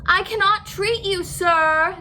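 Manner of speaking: high, loud shout to the fox. A narrator's reading. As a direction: shout him away from the door. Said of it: "Doctor DeSoto shouted".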